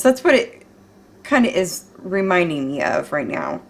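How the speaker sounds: ordinary talk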